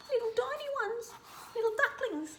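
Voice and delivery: high soft whispered coo. Real, not acted. Reader coos at the ducklings, high whisper. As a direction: coo over the tiny ducklings.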